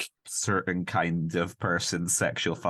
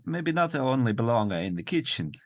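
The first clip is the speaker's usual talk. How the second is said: higher, hammed-up Italian accent; 7 semitones higher than usual. Man reconsidering women's uses, mock Italian accent. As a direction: muse aloud as a sexist Italian man. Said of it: "That's where he's like".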